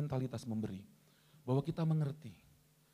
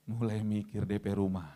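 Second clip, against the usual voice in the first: slow, low second thoughts; mock hesitation; 6 semitones lower than usual. Preacher voices congregation weighing a house deposit, slow mock hesitation. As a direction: drop low and slow, as if calculating. Deflating reply to huge sum.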